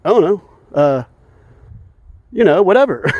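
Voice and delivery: mocking a shrugging official; dismissive mock reply. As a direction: brush it off like a clueless official. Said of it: "They go".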